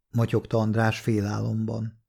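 ordinary delivery

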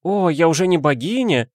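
high-pitched mock-offended cry; exclaiming madame. A narrator's reading. Madame complaining she is no longer goddess, exclaiming. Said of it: "вскричала женщина".

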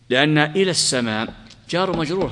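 usual speaking voice

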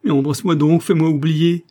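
woman begging her lover to make her forget, desperately; desperate plea. Acted. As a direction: plead as if nothing else could save you. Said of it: "dit-elle désespérément".

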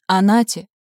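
loud call over the rushing wind; shouting over wind. A narrator's reading. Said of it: "перекрикивая ветер".

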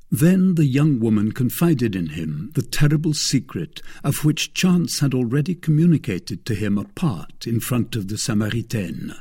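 ordinary delivery, nothing marked

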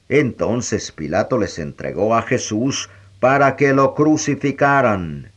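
plain reading voice

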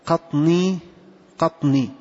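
"قطني" as qatnee, still ط then long nee; added nun and ya. Shown as a demonstration. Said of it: "بالنون والياء".

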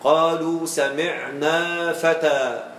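very slow recitation, "فتى" ending on a long aa; measured verse reading stopping open on "فتى"; pausal alif in recitation. Shown as a demonstration. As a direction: stretch every long vowel up to "فتى", no n. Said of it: "وتقرأ قوله تعالى".

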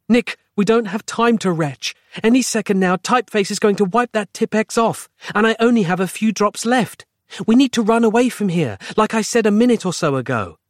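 high, pressing voice; urgent woman character. Acted. Roz hurrying her retching friend, urgent. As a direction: urge him to get moving.

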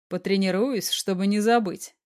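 ordinary delivery, nothing marked

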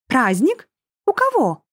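high, mocking disbelief; haughty lady. A narrator's reading. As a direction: arch an eyebrow and sneer. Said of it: "приподняла бровь Фифа". (надменная дама, насмешливо)

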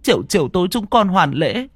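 a grandmother apologising to the tree spirit, pleading; old woman pleading prayer. Acted. Old woman praying at shrine. (giọng bà lão khấn vái van xin)

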